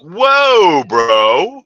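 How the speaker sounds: loud, high, stretched-out whoa, dropping on bro; acted astonishment. Friend reacting to divorce news, mock astonished. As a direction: re-enact being stunned by a buddy.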